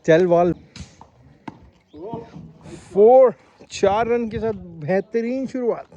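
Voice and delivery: loud, high announcer hype; over-the-top Hindi cricket caller; mock cricket commentary. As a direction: call the match like a TV commentator.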